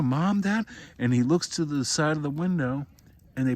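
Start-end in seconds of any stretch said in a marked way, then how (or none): 0.0-0.7 high, loud anxious call; frightened calling. Retold or imitated. Boy tapping the car window, calling his parents high and scared.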